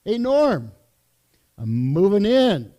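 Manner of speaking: loud, cheery call to his friend Norm; mock announcement. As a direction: barge in loudly, hamming it up. Jokingly announcing moving in on friend.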